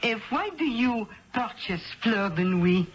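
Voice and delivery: put-on a Midwestern lady faking a French accent; thick put-on French accent, a bit overdone; phony French accent. Perfume rep posing as French, phony French accent. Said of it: "It was a phony French accent".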